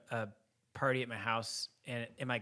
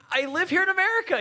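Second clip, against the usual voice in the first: much higher, louder incredulous retort; exasperated protest; 6 dB louder, faster than usual. Retold or imitated. Host answering the guest about his flag, exasperated protest. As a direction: let the voice leap up in mock outrage.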